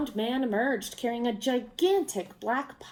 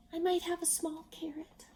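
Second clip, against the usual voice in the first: put-on whispered, shy little girl; timid child; 7 semitones higher, 7 dB quieter than usual. A narrator's reading. Shy child speaking up to the travelers, whispering. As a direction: offer it in a tiny whisper. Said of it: "A child timidly raised her hand".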